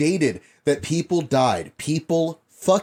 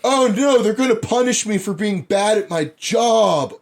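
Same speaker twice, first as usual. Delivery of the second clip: higher, louder whining; whiny mock minister; 6 semitones higher, 4 dB louder than usual. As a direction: whine like a sulking politician.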